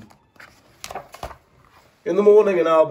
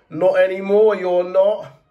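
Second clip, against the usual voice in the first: higher, louder grumpy bottle; grumpy shampoo bottle; 7 semitones higher, 6 dB louder than usual. Acted. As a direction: snap it like a grumpy old bottle. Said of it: "said the grumpy shampoo bottle".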